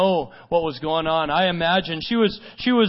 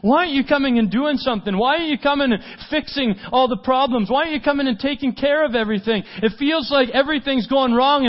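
high, urgent, piled-up questions; exasperated pleading; 8 semitones higher, faster than usual. Acted. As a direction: preach it as a desperate complaint. Piles up complaints to Jesus.

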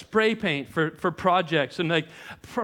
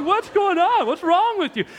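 alarmed disbelief; 9 semitones higher, 4 dB louder than usual. Retold or imitated.

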